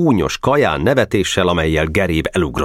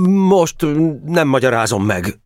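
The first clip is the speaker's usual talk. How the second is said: high-pitched, halting stammer; 10 semitones higher than usual. A narrator's reading. Geréb evading the president's question, stammering. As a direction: falter after the first word. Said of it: "dadogta Geréb".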